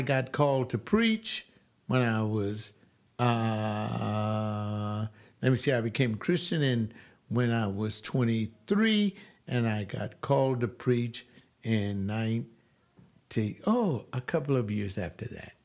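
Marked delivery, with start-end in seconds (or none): none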